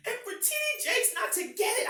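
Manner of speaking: fighting back real tears; choked up while telling her story; voice breaking. Real, not acted. Reliving trauma, voice breaking.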